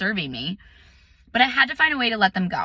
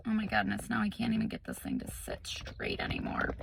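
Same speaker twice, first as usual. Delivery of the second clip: whispered aside; 13 dB quieter than usual. Real, not acted. Fiddling with the camera, muttering in a whisper. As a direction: whisper to herself, barely audible.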